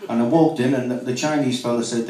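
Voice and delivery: normal talking voice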